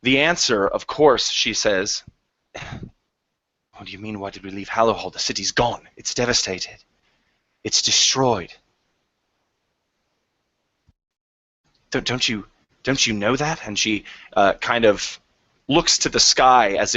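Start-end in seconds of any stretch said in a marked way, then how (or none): 2.5-13.7 a lightly English refugee, quiet and disbelieving; soft English accent, stunned that they ask; hint of English accent, dumbfounded; Queen's English. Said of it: "you are speaking the Queen's English".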